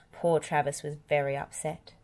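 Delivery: plain reading voice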